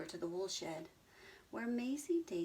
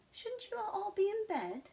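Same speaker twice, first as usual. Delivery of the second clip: high, lightly voiced cow; a gentle motherly tut; mild scolding cow; 13 semitones higher, 3 dB louder than usual. Abigail the cow telling animals to bed, mild scold.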